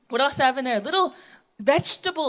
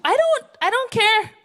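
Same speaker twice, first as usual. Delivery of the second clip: indignant retort; 8 semitones higher, 3 dB louder than usual. Retold or imitated. Auntie's retort in quoted argument.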